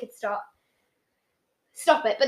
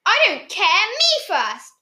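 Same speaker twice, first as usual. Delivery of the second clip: put-on louder, higher childish defiance; defiant retort; 6 semitones higher, 5 dB louder, slower than usual. A narrator's reading. Small boy retaliating against his brother, raised defiant voice. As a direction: fire it back like a stubborn small boy. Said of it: "retaliated the small boy".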